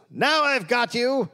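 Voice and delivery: high hammy villain cry; silent film villain. Retold or imitated. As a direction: gloat it like a melodrama villain. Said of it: "like silent film villain".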